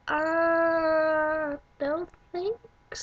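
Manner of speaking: long high "uh", then a hesitant decline; imitated uneasy refusal. Friends refusing the creepy tattoo guy, imitated drawn-out high refusal. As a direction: stretch it out high, creeped out and reluctant.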